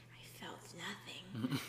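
slow, whispered and eerie; creepy woman voice. Retold or imitated. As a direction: breathe it like a cold killer. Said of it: "she talks like a creepy woman".

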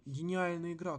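normal talking voice

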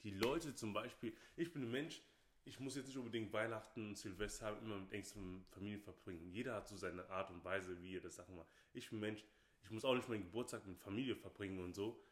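usual speaking voice

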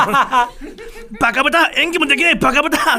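high, laughing re-enacted outburst; loud self-scolding. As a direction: yell abuse at yourself, half laughing. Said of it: "思わず大きな声で". (大声で自分を罵る)

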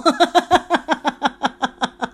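long, high, loud nervous cackle; uncomfortable laugh. Real, not acted. Host laughing because it makes them uncomfortable, nervous laughter. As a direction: burst into a drawn-out uneasy laugh. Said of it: "I'm laughing because it makes me uncomfortable".

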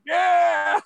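high, drawn-out holler; imitated crowd cheer. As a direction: answer like a whole hall shouting back. Crowd answering the loud caller.

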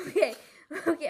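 fast, loud words through a laugh; laughing hard. Real, not acted. Host trying to move on, laughing too hard to go on. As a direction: try to talk but keep cracking up. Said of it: "I'm laughing too hard".

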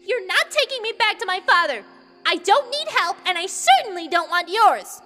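high, indignant protest; furious high-pitched princess tantrum; angry outburst. A narrator's reading. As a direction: burst out in defiant anger. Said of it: "Ruto burst out angrily".

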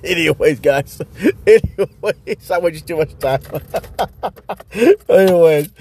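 real giggles breaking into the words; laughing. Real, not acted. Storyteller laughing at his long rant, amused. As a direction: try to wrap up while cracking up.

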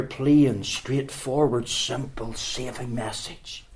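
usual speaking voice

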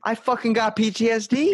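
high, half-joking exclamation of his own PTSD; mock self-diagnosis. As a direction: blurt it out high, playing at being traumatised. Joking he has trauma too.

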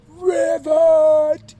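very loud, high, drawn-out ribbit; high, held frog shout; shouted frog call. Retold or imitated. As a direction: yell the ribbit like a frog at full volume. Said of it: "He stands up on that table".

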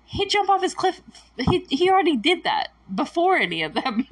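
excited retort. Retold or imitated. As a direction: fire back proudly for Mike. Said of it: "And I'm like".

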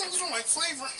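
high laughing disbelief. Retold or imitated. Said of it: "They be like".

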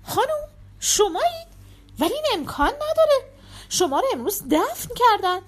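high-pitched, incredulous questioning; astonished. A narrator's reading. Servant to the mistress he saw buried today, astonished. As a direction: gape in disbelief at the impossible. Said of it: "یوهانس با حیرت گفت".

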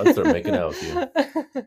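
said through laughter, very high; laughing. Real, not acted. Husband laughing as he jokes about making out with his wife. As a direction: giggle out the joke.

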